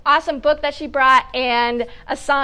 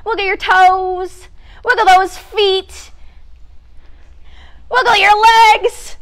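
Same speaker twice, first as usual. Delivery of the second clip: higher, louder sing-song coaching; upbeat exercise leader; 6 semitones higher, 5 dB louder than usual. Acted. Host to children at storytime, bright sing-song coaching. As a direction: cheer the kids through each wiggle. Leads kids' wiggle warm-up.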